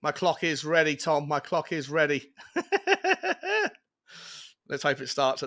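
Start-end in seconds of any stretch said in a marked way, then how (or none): 2.4-2.6 laughing through the line, then a high laugh. Real, not acted. Host laughing about his clock to Tom. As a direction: chuckle at your own clock.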